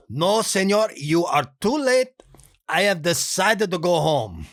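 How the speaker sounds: put-on a nice guy begging off in a thick Spanish accent; hint of dubbed Spanish accent; Benito voice. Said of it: "sounding a little bit too close to Juan's voice".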